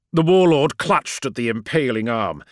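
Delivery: ordinary delivery, nothing marked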